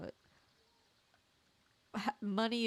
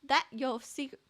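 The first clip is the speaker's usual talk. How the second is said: higher, lightly voiced arch reporter; sly reporter voice; 7 semitones higher than usual. Reporter Dawn challenging the villain, mild arch higher voice. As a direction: purr the question with a touch of sass.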